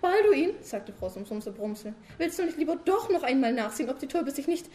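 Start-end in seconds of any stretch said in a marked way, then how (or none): 2.2-4.7 high, loud wife pestering her sleepy husband; nagging wife. Acted. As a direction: keep needling him with a raised voice. Frau Sumsum als Figur gesprochen. (hohe, laute nörgelnde Ehefrau)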